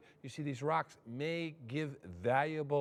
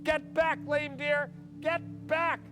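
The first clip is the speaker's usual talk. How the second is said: yell; 9 semitones higher, slower than usual. Retold or imitated. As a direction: yell at him to get back.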